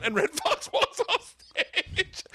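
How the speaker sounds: high, drawn-out words breaking into laughter; laughing through punchline. Real, not acted. Comic delivering the story's punchline, laughing. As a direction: crack up while saying it.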